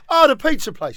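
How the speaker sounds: high, loud mock recognition; clueless listener. Listener mistaking Da Vinci for a pizza place, excited recognition. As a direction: pipe up as the penny drops.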